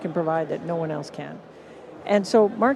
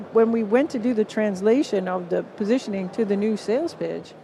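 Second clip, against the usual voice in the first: ordinary talk, nothing marked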